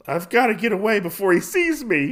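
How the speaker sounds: high, alarmed informant voice; nervous mock panic. Scared informant to himself before being seen, mock panic. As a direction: play up the urge to flee. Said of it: "he gulps and he's like".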